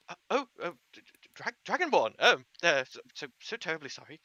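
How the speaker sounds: high, stuttering, startled little gnome; stammering gnome. Acted. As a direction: trip over the words on seeing a Dragonborn. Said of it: "you and goes".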